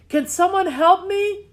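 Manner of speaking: high, loud call for help; yelling for help. A narrator's reading. Lost girl yelling for help in the woods. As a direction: shout out into the woods. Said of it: "she yelled".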